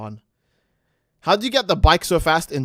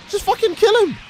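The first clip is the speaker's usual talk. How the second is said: exasperated yell; 14 semitones higher than usual. Real, not acted. Frustrated outburst at Gohan.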